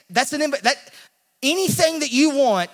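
preacher building to the promise; rising emphasis. Real, not acted. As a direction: push it up with fervor.

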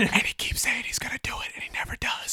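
teasing whisper. Real, not acted. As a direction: whisper the tease.

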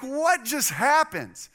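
high, loud, drawn-out disbelief; stunned, high-pitched outburst; startled shout. Retold or imitated. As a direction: shout it slowly, shocked by a sudden bang. Startled reaction to window exploding.

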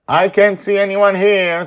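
put-on high, loud boy voice announcing; announcing kid. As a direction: report it like an excited boy. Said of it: "Charlie announces".

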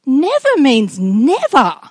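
both never leaned on, slow and deliberate; slow, weighty never means never. Shown as a demonstration. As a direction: let never means never land slowly. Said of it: "it will occur to me".

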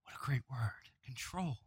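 hushed whisper on control. Acted. Preacher whispering the key word to congregation. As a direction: lean in and whisper it. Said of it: "whispered emphasis".

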